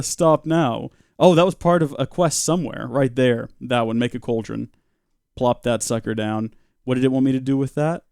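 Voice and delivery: normal talking voice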